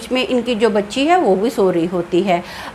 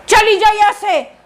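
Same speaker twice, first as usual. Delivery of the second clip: angry shout; 8 semitones higher than usual. A narrator's reading. Possessed husband slaps wife.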